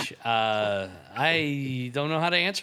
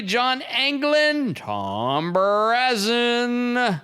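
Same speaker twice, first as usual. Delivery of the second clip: drawn-out names, called out like a host; announcer roll call; 5 semitones higher, slower than usual. Acted. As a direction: announce each name with flourish. Reads sponsor names ceremonially.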